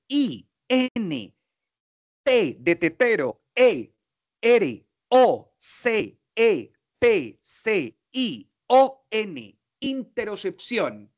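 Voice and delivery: letters spaced one by one with pauses, then "interocepción" said whole with a fall; spelling it out. Shown as a demonstration. Teacher spelling the word for Juan Camilo, letter by letter. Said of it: "Se lo deletreo".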